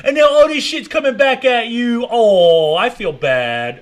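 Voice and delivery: high, slow sarcastic pity; slow mock sympathy, dripping sarcasm. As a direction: fake concern for the guest. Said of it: "He's acting like he's sad".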